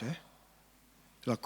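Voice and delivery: normal talking voice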